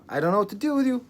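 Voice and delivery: exasperated. Acted.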